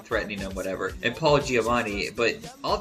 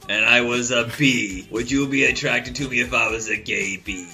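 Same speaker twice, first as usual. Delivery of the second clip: Harvey Fierstein voice; 5 dB louder than usual. Retold or imitated. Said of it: "That really hurts my throat to do".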